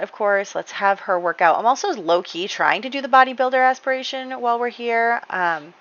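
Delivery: ordinary delivery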